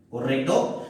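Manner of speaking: normal talking voice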